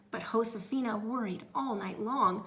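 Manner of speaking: ordinary delivery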